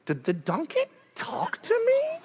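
high, slow puzzled voice; just waking up and wondering; Balaam waking at night, baffled; dazed disbelief. Retold or imitated. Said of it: "woke up one night and went".

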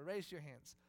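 ordinary talk

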